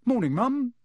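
breezy, winning hello; jaunty greeting. A narrator's reading. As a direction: charm your way out of trouble. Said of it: "jaunty, winning voice".

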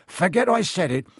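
flustered, trying to stop them going; alarmed calling out. A narrator's reading. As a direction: call them back. Hagrid calls after departing children.

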